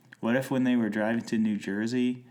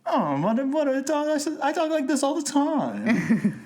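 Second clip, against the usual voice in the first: put-on hammy, much higher Mid-Atlantic accent; mock Mid-Atlantic accent; 4 dB louder than usual. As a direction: overdo a high old-Hollywood Mid-Atlantic accent. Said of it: "Just like my Mid-Atlantic accent".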